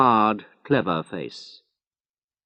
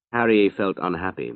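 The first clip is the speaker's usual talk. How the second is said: plain reading voice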